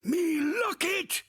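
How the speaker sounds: sudden high, loud cry of delight; overjoyed cry. Acted. Ghost finds his lost locket, shrieking with joy. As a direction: yelp with joy at the sight.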